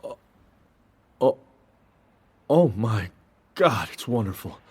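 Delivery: soft, savouring the taste; awed, quiet delight. Acted. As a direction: melt at the first bite. Tasting pie, overwhelmed.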